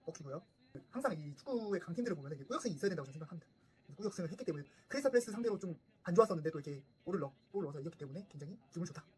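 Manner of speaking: stiff, formal summary to the viewers; formal review. Acted. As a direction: wrap up like a pundit's review. Post-match review to camera. (격식 차린 경기 리뷰 말투)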